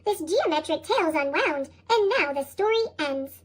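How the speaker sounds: ordinary delivery, nothing marked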